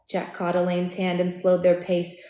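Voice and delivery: plain reading voice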